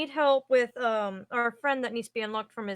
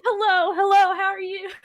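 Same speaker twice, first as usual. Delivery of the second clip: higher, louder bubbly hellos; cheery greeting; 8 semitones higher, 7 dB louder than usual. Acted. Froth Friendly greeting every tree, bubbly and loud. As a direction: call out happily to each tree.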